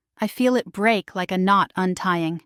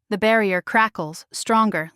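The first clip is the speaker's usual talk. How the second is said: ordinary delivery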